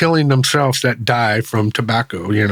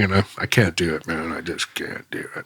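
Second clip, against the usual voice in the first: trailing off into a breathy whisper; words dying away, nearly whispered; fading to a whisper; 7 semitones lower, 8 dB quieter than usual. Real, not acted. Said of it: "trying to hold my face all normal".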